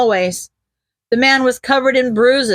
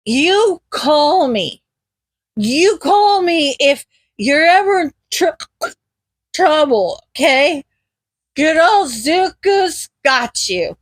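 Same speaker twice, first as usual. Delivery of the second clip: slow, slurred tipsy bravado; slow drunken promise; drunken confidence; 3 semitones higher, slower than usual. Acted. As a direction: drag it out like a happy drunk. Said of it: "voice filled with drunken confidence".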